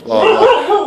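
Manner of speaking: high, whiny mock brush-off; mocking blah blah. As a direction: parrot her nagging dismissively. Mocking wife's advice he ignored.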